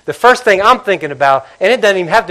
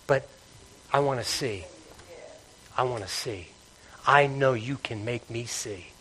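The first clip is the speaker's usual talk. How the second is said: quiet plea; 5 semitones lower, 11 dB quieter than usual. Retold or imitated. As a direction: beg him gently, full of faith. Blind man pleads to Jesus.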